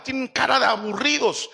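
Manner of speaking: ordinary talk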